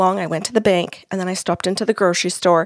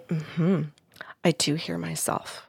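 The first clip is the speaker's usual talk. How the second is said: whispered, much softer, fading; hushed admission; 3 semitones lower, 9 dB quieter, slower than usual. Retold or imitated. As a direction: confess it under your breath. Client quietly admitting.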